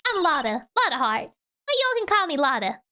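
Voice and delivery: put-on Southern accent. Photographer introducing herself, in a Southern accent. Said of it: "see pull it off correctly".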